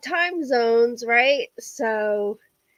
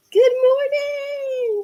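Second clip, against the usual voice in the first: sing-song greeting; 13 semitones higher, 5 dB louder, much slower than usual. Real, not acted. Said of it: "this is applause in sign language".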